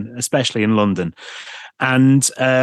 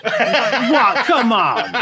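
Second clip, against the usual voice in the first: laughing disbelief; 10 semitones higher, much slower than usual. Real, not acted.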